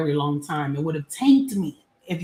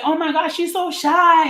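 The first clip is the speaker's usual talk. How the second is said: gushing adults; 11 semitones higher, 5 dB louder than usual. Retold or imitated. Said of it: "people would say".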